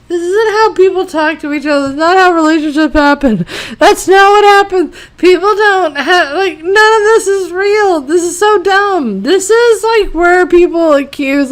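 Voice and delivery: high-pitched, incredulous exasperation; pleading, wound-up complaint; exasperated rant. Real, not acted. Ranting about unrealistic writing.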